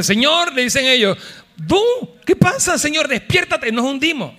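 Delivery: high, frantic cry for help; panicked disciples. Acted. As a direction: beg the sleeping Lord to wake up. (discípulos gritando asustados)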